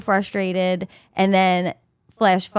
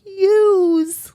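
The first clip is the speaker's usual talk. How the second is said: high, loud New York Italian "Yous"; 11 semitones higher, 4 dB louder than usual. Retold or imitated. Recalling a local's surprising "Yous", New York Italian accent. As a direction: hit "Yous" like Vinny from Brooklyn. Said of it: "it's my cousin Vinny".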